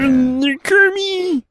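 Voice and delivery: high slow Kermit croak. Pretend. Joking the singer sounds like Kermit, in a high Kermit voice. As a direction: do a quick Kermit. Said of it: "He does sound like a Muppet".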